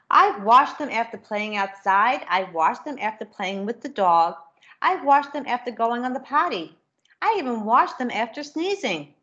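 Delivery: lively storybook reading with character voices; reading to children. A narrator's reading. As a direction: read it brightly to a class of kids. Reads picture book to children.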